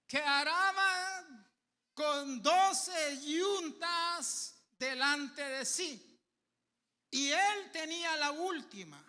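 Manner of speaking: loud, slow preaching read; fervent scripture reading. Acted. Preacher reads First Kings to church, loud and fervent. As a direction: thunder the Bible verse out, slowly.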